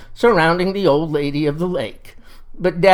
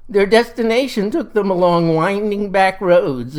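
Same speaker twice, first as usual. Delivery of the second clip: usual speaking voice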